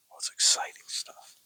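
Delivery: soft excited aside; whispered aside. Real, not acted. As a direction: breath-only whisper. Hushed aside amid the dramatic reading.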